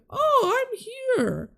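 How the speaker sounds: put-on high, slow old-lady falsetto; hammed-up Mrs Doubtfire-style granny. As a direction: put on a doddering old woman. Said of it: "old lady vibes".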